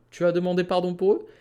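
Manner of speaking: rising "eux" at the end, quick; as a question. Shown as a demonstration. Teacher giving the question reading, rising "eux". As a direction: ask it with a lift on "eux". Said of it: "Mais si je dis".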